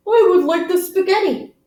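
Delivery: a high, lightly put-on kid voice; child customer voice. Teacher voicing Amir ordering: lightly voiced high child. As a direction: order spaghetti like a small child.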